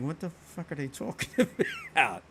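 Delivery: mock bewilderment, breaking into laughter; baffled outsider. Outsider overhearing a Philly conversation, mock bafflement with a laugh. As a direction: play a confused listener, laughing through it. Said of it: "anybody outside of that who would listen".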